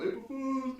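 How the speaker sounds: high-pitched, softly delivered gotcha; teasing prank reveal. Retold or imitated. She reveals the prank on the phone, high teasing mimicry. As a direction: lilt it high and light, mimicking her.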